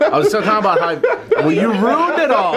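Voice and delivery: laughing through the words. Real, not acted. Teasing after partner's interruption.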